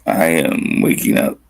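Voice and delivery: groggy just-woke-up voice; sleepy voice. Real, not acted. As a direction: say it half asleep, slow. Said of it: "You got that sleepy voice".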